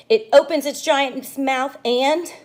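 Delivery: dramatic buildup. Acted. Suspense peak of shark story.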